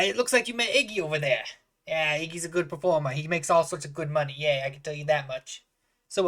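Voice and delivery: eager, folksy barkeep patter; chatty halfling bartender. Acted. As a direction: welcome a customer as an excitable innkeeper.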